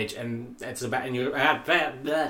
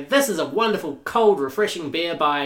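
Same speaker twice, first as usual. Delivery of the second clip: ad voice; 5 semitones higher, 5 dB louder than usual. Shown as a demonstration. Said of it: "You say".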